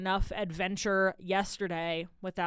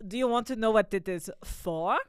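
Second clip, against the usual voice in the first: put-on Central European accent, rising question; imitated European accent; Swiss Dutch accent; 3 dB louder than usual. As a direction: mimic a Swiss-Dutch man asking. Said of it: "I'm doing a Swiss Dutch accent thing".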